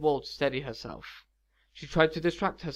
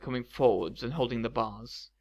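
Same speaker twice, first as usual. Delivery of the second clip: ordinary delivery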